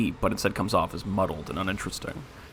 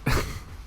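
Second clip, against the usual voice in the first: breathy chuckle; short unvoiced laugh. Real, not acted. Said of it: "that line just really made me laugh".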